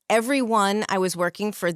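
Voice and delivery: usual speaking voice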